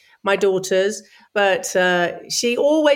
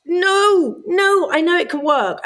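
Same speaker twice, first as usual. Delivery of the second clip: high, raised-voice mock pleading; insistent protest; 9 semitones higher, 3 dB louder, slower than usual. Seeing somebody about a show, insistent protest. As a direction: push back against the rejection.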